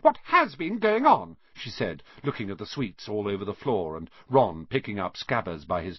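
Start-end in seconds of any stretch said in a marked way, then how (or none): 0.0-1.4 high, loud, indignant question; shocked demand. Acted. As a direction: demand an explanation, pitched high. Hermione walks in on a mess.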